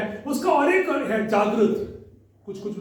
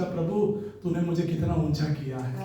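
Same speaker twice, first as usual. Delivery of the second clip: hushed grateful prayer; 7 semitones lower, 6 dB quieter than usual. Retold or imitated. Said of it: "यहाँ बैठकर मैंने सोचा".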